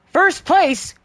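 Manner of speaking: high, loud shocked question; can't believe what you just saw; stunned disbelief. Real, not acted. Shocked to have won first.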